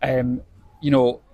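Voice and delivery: ordinary talk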